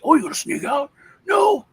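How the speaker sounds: high, loud burst; mock shout. Dad bursting through the door to shout at plotting son. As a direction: throw the door open and yell it.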